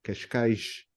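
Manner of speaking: "Cascais" quietly, sh then zh; soft "Cascais", "cashkaizh"; Portuguese "Cascais" with two different s sounds. Shown as a demonstration. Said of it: "the first sh is different from the last".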